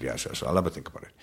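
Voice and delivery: quick, quiet imitation of Dad agreeing; imitated dad. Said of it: "And he said".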